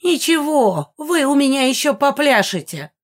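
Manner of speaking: high, loud gloating threat; gleefully vengeful old ghost; menacing old woman. A narrator's reading. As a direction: promise revenge in a high old-hag voice. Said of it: "с жутким хохотом обещала старуха".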